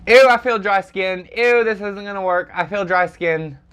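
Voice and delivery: ordinary talk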